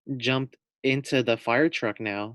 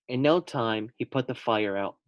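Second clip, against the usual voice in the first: ordinary delivery, nothing marked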